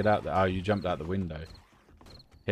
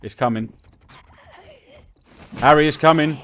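building from a raised call to a high shout; yelling across the room; 9 semitones higher, 14 dB louder than usual. Real, not acted. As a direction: call out louder and louder in panic. Zombie approaching, calls friend.

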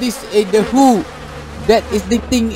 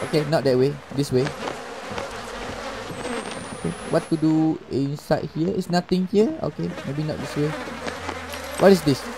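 ordinary delivery

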